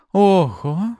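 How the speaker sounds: high exclamation of delighted awe; exclaim, thoroughly impressed; admiring. A narrator's reading. Artara watching the hero snatch the key, admiring. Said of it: "восхищённо выдохнула она".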